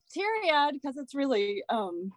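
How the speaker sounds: laughing through welling tears; apologetic, starting to choke up; holding back tears. Real, not acted. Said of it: "I might get a little teary-eyed".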